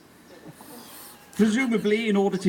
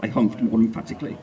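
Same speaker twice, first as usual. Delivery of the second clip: usual speaking voice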